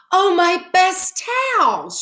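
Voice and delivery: high, loud, slow dismayed wail. Acted. Mrs Rogers finds her best towels ruined, wailing high and slow.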